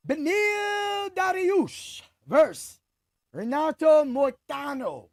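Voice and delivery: drawn-out, high ring-announcer call. Pretend. Host introducing the next fight to listeners, ring announcer. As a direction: call out the matchup loud and slow.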